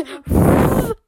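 loud rushing whoosh; high airy door whoosh; automatic door noise. Retold or imitated. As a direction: imitate the door sliding open. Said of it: "so the door goes".

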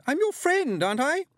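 very high, loud appeal; terrified cry. A narrator's reading. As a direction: plead shrilly for mercy. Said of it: "she cried".

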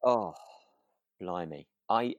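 ordinary talk

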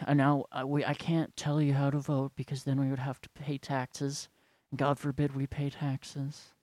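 lightly voiced sanctimonious church elder; subdued mock sermon with long pauses; droning church elder. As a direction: parody a leader's halting, solemn aside. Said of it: "they're all talking like this".

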